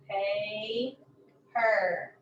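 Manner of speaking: "Paper" drawn out in two separate syllables; split syllables. Shown as a demonstration. Teacher showing where the syllable ends, "Paper" split in two. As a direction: hold the first syllable of "Paper", pause, finish. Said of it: "The end of the first syllable".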